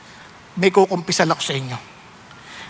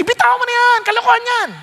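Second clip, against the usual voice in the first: high, louder scoffing tempter; tempter's voice; 13 semitones higher, 3 dB louder, faster than usual. Acted. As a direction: scoff and urge letting go. (mapangutyang boses ng tukso)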